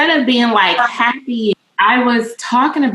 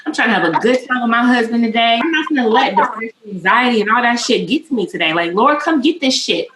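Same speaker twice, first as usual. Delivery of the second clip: higher, heated, defiant self-talk; retold fired-up refusal; 4 semitones higher than usual. On the elliptical, refusing depression, retold as a fired-up outburst. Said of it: "I was like".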